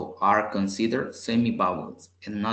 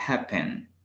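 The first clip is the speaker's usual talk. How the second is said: happen with the stress on HAP; stress on first syllable. Shown as a demonstration. Teacher showing learners where the accent falls, first-syllable stress. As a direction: HAP stronger, pen falling away. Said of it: "El acento se le escucha al inicio".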